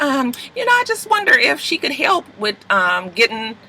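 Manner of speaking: put-on high-pitched, tentative girlfriend voice; coy request. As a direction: mimic a girlfriend hesitantly floating a favor. Retold Meg asking a delicate favor.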